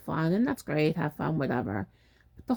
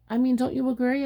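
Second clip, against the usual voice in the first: usual speaking voice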